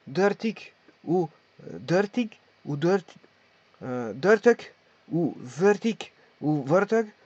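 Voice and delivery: "dertig" and "veertig" said slowly, each repeated; slow, careful model of "dertig" then "veertig"; Dutch number words. Shown as a demonstration. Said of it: "tout ça, c'est compréhensible".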